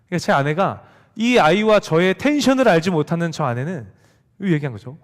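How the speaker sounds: usual speaking voice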